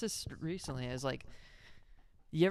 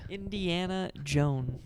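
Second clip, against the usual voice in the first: slow drawn-out; 4 semitones higher, 5 dB louder, slower than usual. Shown as a demonstration. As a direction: stretch Indiana Joan out slowly. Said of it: "the singular".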